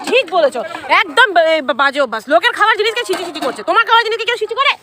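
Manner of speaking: heated rebuke over food; animated scolding. Real, not acted. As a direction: tell them off loudly. Scolding someone over food habits.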